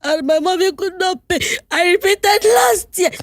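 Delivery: high, slow, tearful wail; crying out the bad news, slow and high; retold crying. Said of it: "I busted into tears".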